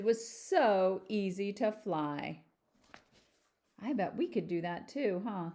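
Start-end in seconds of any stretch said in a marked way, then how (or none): none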